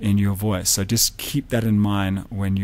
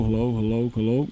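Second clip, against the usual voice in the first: voice from behind the mic. Shown as a demonstration. Teacher tests the back of the SM58 to show how it rejects the voice. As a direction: say hello round the back of the mic. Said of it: "You can hear the rejection of this guy here".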